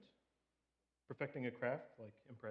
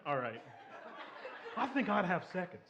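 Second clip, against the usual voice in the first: Harry Carey voice; 4 semitones higher, 4 dB louder than usual. Retold or imitated. Joke punchline in Harry Carey voice.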